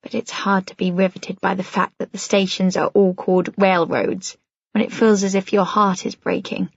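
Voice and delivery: ordinary delivery, nothing marked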